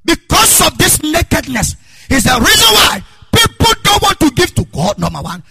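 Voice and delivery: loud, high, driving sermon; preaching at full force. Acted. Pastor shouting his points to the congregation, full force. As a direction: hammer each point home.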